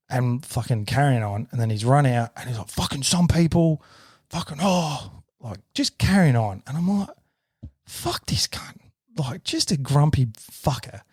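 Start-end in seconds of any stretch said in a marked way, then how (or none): 2.7-5.1 higher, drawn-out mock grumbling; retold grumpy griping. Retelling grumpy man carrying on. 7.9-8.8 voiceless retold aside; whispered curse. Retelling private reaction to the man.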